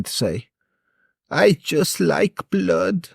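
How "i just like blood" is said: put-on slow, eerie German-accented boy; creepy German accent. Ten-year-old boy explaining himself, slow spooky German accent. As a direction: drag it out in a chilling German accent, slowly. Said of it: "in a German accent".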